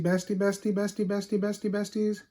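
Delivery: repeating bestie with growing excitement; excited repetition. Real, not acted. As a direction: gush over the best friend. Excited emphasis on best friend.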